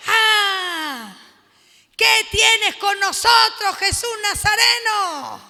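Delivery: loud, slow, high snarl of a demon; demon crying out. Acted. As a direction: cry it out like a cornered demon. Preacher voices demon crying out.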